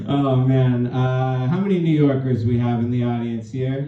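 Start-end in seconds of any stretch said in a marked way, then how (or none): none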